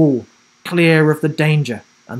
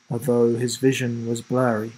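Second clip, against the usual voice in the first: ordinary delivery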